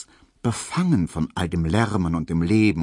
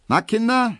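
high, loud cheery call from the coach box; cheerful hearse driver calling; 5 dB louder than usual. A narrator's reading. Hearse driver calls cheerily to the gawking crowd, high and loud. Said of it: "rief der fröhliche Leichenkutscher".

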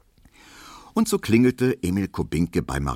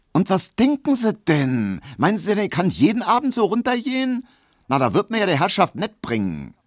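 high, loud maid's tirade; indignant scolding; 13 semitones higher, 4 dB louder than usual. A narrator's reading. Maid scolds admirer who wants her downstairs nightly, indignantly. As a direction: tell him off in a raised, high voice.

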